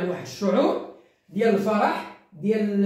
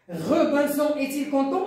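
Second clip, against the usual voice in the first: high question, rising on "content"; question intonation; 4 semitones higher than usual. Shown as a demonstration. Teacher answers the inversion exercise, question intonation. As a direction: ask it with "content" lifted at the end. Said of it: "on a posé la question".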